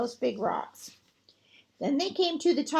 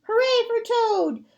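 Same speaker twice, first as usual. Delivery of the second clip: much higher, louder cheer; cheering shout; 9 semitones higher, 4 dB louder than usual. Acted. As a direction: shout it like a fan in the crowd. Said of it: "and he shouted".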